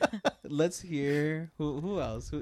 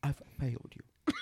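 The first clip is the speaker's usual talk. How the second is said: softer, lower, half-laughing Alfred; Michael Caine impression; 4 semitones lower, 6 dB quieter than usual. Asked for Michael Caine, a softer lowered butler apology. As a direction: hush it like a sorry butler. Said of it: "Michael Caine".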